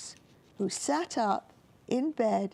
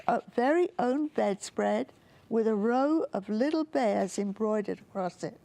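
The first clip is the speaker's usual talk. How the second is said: plain reading voice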